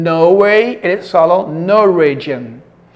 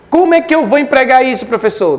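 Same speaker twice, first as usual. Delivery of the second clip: mock student question; 6 semitones higher, much faster than usual. Teacher voices student question.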